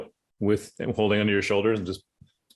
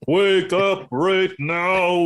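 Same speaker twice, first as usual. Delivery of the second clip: high, loud, slow wake-up shout; loud wake-up call; 8 semitones higher, 5 dB louder, much slower than usual. Acted. Monk shaking sleeping Waif awake, loud slow shout. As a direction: bellow her awake, dragging each word.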